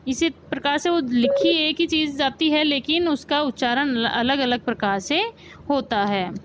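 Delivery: ordinary delivery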